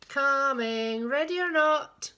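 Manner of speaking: loud singsong seeker's call; drawn-out playful holler. Acted. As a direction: call out to the hiders, stretching the words. Seeker calls out.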